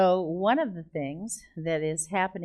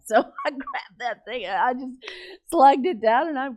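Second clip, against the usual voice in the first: loud, higher, laughing through the words; 6 semitones higher, 6 dB louder than usual. Real, not acted. Preacher confessing how she gulped the wine, laughing. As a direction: tell it through real laughter. Laughs while retelling her blunder.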